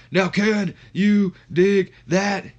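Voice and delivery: slow, high, loud catchphrase; Booker T. Acted. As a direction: stretch each word out like a ring shout. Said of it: "And then Booker interrupts".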